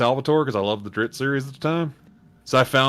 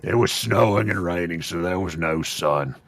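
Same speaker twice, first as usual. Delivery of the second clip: orc voice; 5 semitones lower than usual. Acted. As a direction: read aloud as an orc, lurching from low drone to bellow. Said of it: "I'll do it in the orc voice".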